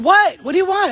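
high, loud call back to an unseen voice; startled call, pitched high and loud. Retold or imitated. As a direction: shout back into the room. Answering a voice calling her.

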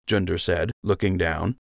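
plain reading voice

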